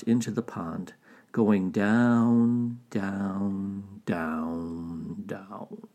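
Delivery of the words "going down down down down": slow descent from usual voice to whisper; sinking fade. A narrator's reading. Narrator follows Tortoise sinking into pond, fading away. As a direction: let each word sink softer until it vanishes.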